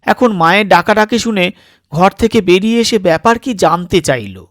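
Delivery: ordinary delivery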